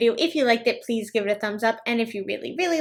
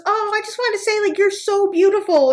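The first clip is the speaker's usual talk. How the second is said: high, gushing compliment; butter her up sweetly; high sweet stranger; 9 semitones higher than usual. Retold or imitated. Saleswoman complimenting a stranger, high and sweet. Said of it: "she goes".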